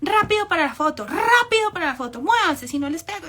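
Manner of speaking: high, loud bossy mock tirade; imitated yelling. Comedian threatening family into a photo pose, mock angry shouting. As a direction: shout threats, parodying a tyrant. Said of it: "empezó a gritar".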